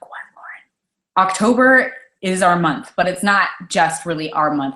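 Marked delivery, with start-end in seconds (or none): 0.0-1.3 mock whisper. Imagined viewer asks her.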